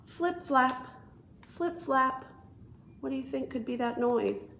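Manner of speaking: plain reading voice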